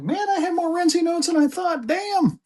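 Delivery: very high squeaky amazement; high-pitched marvelling at a find; surprised exclamation. Real, not acted. Exclaiming at notes found.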